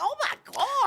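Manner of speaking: high-pitched, stressed-out "oh my God"; retold panicky exclamation; mock anxious exclamation. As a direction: exclaim it high, as if overwhelmed by anxiety. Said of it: "anxiety central".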